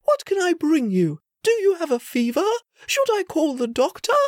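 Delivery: high, fussing motherly voice; worried mother. Acted. Queen fussing over her sick son, worried. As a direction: fuss over a sick child.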